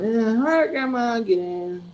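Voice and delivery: high, drawn-out call; high-pitched retold call; calling to Grandma. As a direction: imitate hollering to an old relative. Cousin calls grandma into the car.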